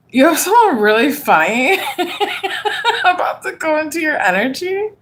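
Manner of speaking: laughing. Real, not acted. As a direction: try to read through the giggles. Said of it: "I can't stop laughing".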